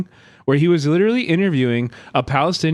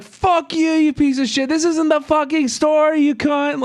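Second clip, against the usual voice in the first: screaming profanities; 13 semitones higher than usual. Retold or imitated. Screaming at the reporter to ruin his audio, high and furious. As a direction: scream it all out, furious and shrill. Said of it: "screaming profanities at the top of my lungs".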